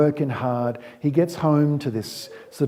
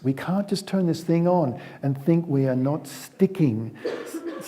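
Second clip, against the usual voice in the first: ordinary talk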